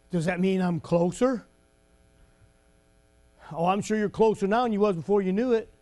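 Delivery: ordinary delivery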